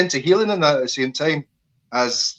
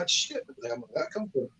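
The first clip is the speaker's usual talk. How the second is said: a jolt of surprise, pitched high and hushed; startled exclamation; 11 semitones higher, 9 dB quieter than usual. Retold or imitated. Acting out a brain zap for his friend, startled high and hushed. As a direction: caught off guard, high and soft.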